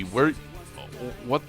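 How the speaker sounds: usual speaking voice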